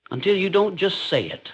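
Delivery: ordinary talk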